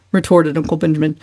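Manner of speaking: ordinary delivery, nothing marked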